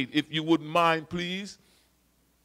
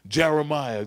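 normal talking voice

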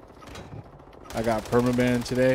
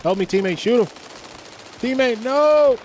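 panicked shout; 11 semitones higher, 7 dB louder than usual. Real, not acted. Teammate about to die.

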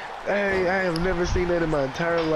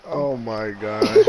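normal talking voice

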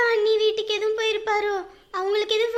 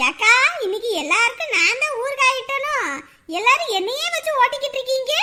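usual speaking voice

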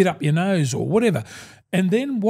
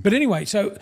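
ordinary talk, nothing marked

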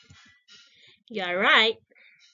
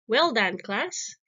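ordinary talk, nothing marked

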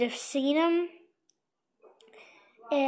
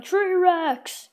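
kid's mock battle yell, higher; yelling the name; 4 semitones higher, 5 dB louder than usual. Kid voicing a toy hero on its portal, mock yell. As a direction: shout the hero's name like a war cry. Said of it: "and he's yelling".

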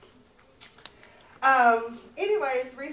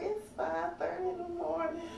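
weary mutter; 4 semitones higher, 9 dB quieter than usual. Retold or imitated. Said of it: "I'm just like".

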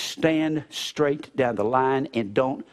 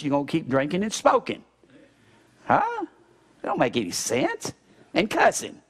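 ordinary delivery

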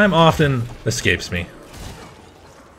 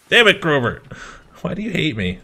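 exasperated; 6 semitones higher than usual. Real, not acted. Kruber healed him again.

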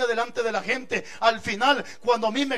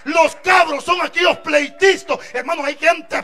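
a toda voz, muy fuerte y sostenido; proclamación bíblica; 5 semitones higher, 9 dB louder than usual. Acted. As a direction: proclama la Escritura como un pregón. Predica con fuerza. (el predicador proclama el versículo a la iglesia a toda voz)

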